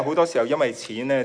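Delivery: ordinary talk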